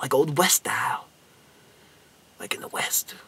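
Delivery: whispered, hushed teaser; dramatic whisper. Acted. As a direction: whisper it like a secret showdown. Building up the shootout.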